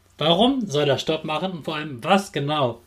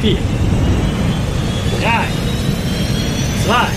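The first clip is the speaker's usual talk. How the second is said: countdown shout; 10 semitones higher, 7 dB louder, much slower than usual. Real, not acted. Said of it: "Alle zusammen".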